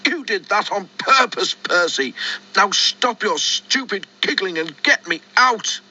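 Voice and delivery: high indignant accusation; angry. Acted. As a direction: scold him, indignant and high. Thomas raging at Percy.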